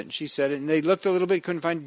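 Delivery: usual speaking voice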